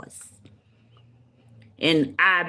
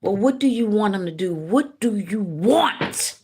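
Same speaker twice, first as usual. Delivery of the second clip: insistent questioning; 4 semitones higher than usual. Retold or imitated. Spirit asking her what she wants, insistent questioning. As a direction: press the question, stretching the last words. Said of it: "then my spirit said".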